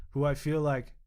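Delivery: normal talking voice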